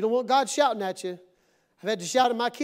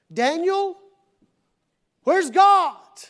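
loud, high call with a shouted finish; 7 semitones higher, 9 dB louder, much slower than usual. Acted. Preacher putting the question to Daniel in the den, calling out. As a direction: shout it out to someone far off.

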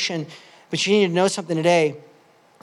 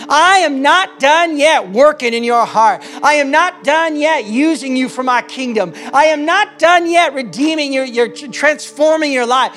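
preaching at full force; 6 semitones higher, 5 dB louder than usual. Acted. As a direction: hammer home the promise again and again. Preaching God's voice.

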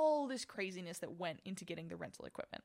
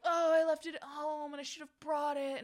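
mock dramatic; 8 semitones higher, 6 dB louder than usual. Mocking her own earlier fuss.

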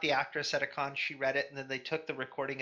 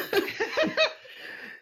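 high breathy laugh; 6 dB louder than usual. Real, not acted. Laughs at odd quote.

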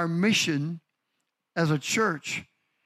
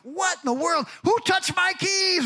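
frustrated shout; 13 semitones higher than usual. Retold or imitated. Pastor yelling at grandkids over missing keys. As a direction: demand to know who took the keys. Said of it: "I'm, like, getting frustrated".

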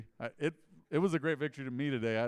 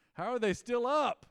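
amazed; 11 semitones higher, 6 dB louder than usual. Retold or imitated. Amazed boxing spectator.

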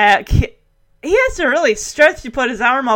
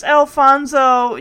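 ordinary delivery

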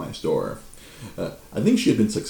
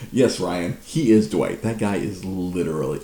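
ordinary talk, nothing marked

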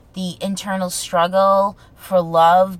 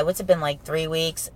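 ordinary talk, nothing marked

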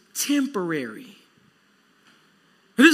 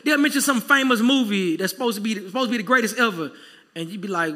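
ordinary talk, nothing marked

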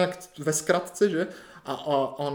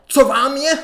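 high, loud brusque question; imitated yelling doctor; 9 semitones higher, 9 dB louder, slower than usual. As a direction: shout it as an overbearing doctor. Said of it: "po mně křičela". (hlasitý vysoký křik doktorky)

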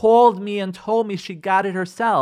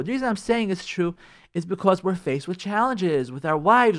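usual speaking voice

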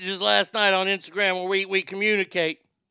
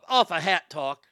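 ordinary delivery

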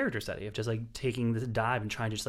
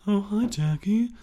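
high, slow mock-casual greeting; Greta Gerwig impression; 10 semitones higher, 5 dB louder, slower than usual. Critic imitating an actress greeting Jackie, mocking impression. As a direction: put on a high, drawn-out actress voice, lightly sending her up. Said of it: "That's my Greta impression".

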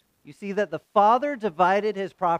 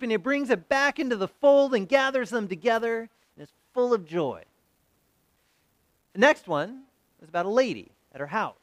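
usual speaking voice

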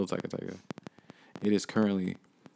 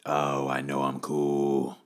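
smug drawl; 7 semitones lower, much slower than usual. Acted.

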